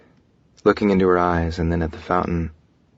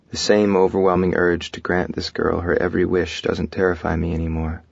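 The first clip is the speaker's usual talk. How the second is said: ordinary delivery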